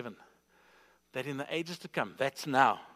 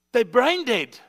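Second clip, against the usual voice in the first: raised, higher-pitched sermon voice; forceful preaching; 8 semitones higher, 9 dB louder than usual. Real, not acted. Preacher warning congregation about living unsaved, forceful preaching. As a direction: press the point with rising intensity.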